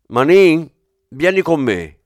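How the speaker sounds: loud summoning call across the room; shouting a name. A narrator's reading. Commissioner loudly calling his inspector to follow, shouting. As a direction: yell for the inspector to come. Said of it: "urlò Aldani".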